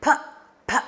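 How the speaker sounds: "P" as short puffs, "puh, puh"; letter sound of P. Shown as a demonstration. Teacher telling children to blow out the candle, "P" puffed as "puh". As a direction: puff out "P" as if blowing out a candle. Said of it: "That's the sound of letter P".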